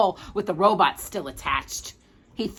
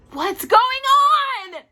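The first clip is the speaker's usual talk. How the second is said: much higher, louder panicked cry; frightened cry; 5 dB louder than usual. Acted. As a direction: cry out in fear as you sink. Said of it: "he cried".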